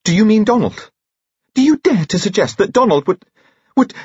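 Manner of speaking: high, loud, outraged challenge; blazing indignation. A narrator's reading. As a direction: round on him furiously, pitch way up. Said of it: "Bridget's eyes blazed as she turned".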